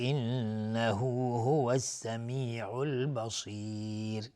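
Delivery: انه هو السمیع البصیر slow, the long vowels stretched; long madd recitation. Shown as a demonstration. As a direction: stretch the vowels of هو and السمیع four to five beats. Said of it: "مد جایز منفصل ... چهار تا پنج حرکت". (تلاوت کشیده با مد چهار تا پنج حرکت)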